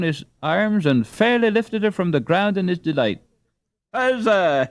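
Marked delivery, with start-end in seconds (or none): 3.9-4.7 a high, loud burst of triumph; joyful cry. A narrator's reading. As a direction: shout it out in sudden delight. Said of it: "he cried".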